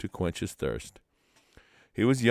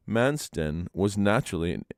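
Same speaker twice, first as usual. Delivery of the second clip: ordinary delivery, nothing marked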